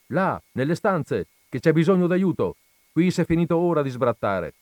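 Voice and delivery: loud commanding shout; shouting orders. A narrator's reading. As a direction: shout across the camp for help.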